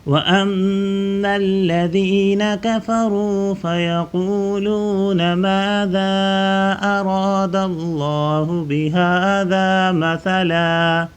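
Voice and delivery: melodic recitation, drawn out; Quran recitation. Acted. Teacher recites the verse for pupils, melodic chant.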